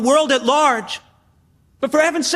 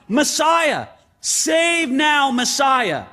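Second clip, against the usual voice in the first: raised, higher-pitched proclamation, retold; crying out; 4 semitones higher, much slower than usual. The crowd hails Jesus as Messiah, crying out. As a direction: call it out like a hailing crowd. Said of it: "It was a way to cry out".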